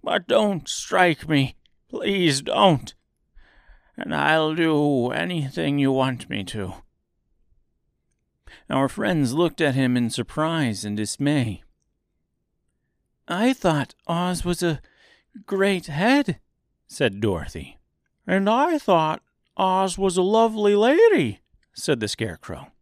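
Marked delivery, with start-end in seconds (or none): 0.0-6.8 high little man begging, a touch of fear; trembling plea. A narrator's reading. Little man begging not to be struck, trembling voice. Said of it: "in a trembling voice". 13.3-16.4 put-on high-pitched girl voice; girl character. A narrator's reading. Girl dismayed at finding a little man, high voice. 18.3-21.4 high, loud scarecrow voice; scarecrow character. A narrator's reading. Scarecrow dismayed at the real Oz, high voice.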